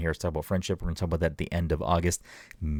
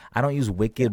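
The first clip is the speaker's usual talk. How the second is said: ordinary delivery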